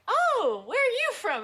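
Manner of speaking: high, loud, exaggerated question; mock surprise. Newcomer hearing Southern accents, loud mock surprise. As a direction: exclaim it in hammed-up astonishment. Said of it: "I'm like".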